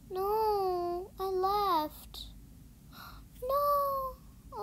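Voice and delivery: upset gasp of disbelief; dismayed cry. Real, not acted. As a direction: realize the mistake and cry out.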